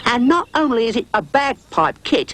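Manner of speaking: boastful, insisting; Rhubarb, proud. A narrator's reading. Rhubarb's voice held.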